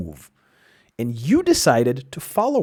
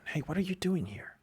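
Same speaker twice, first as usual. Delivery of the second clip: whispered, higher, as the inner voice; still small voice; 7 semitones higher, 9 dB quieter than usual. Acted. The speaker voicing God's still small voice to a listener, in a high whisper. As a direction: breathe it like a secret nudge from inside. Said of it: "A still small voice".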